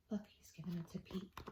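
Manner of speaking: put-on hushed whisper to the kids. Reader whispering to the children about Pete. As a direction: whisper it like a shared secret.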